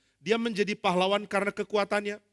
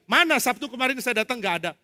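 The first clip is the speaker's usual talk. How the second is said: annoyed; 6 semitones higher, 5 dB louder than usual. Acted. Annoyed WA reply.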